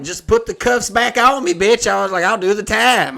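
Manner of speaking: high, loud, hammed-up outrage; mock outraged yell. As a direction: shout it like you'd rather go to jail. Mock outrage at card fee.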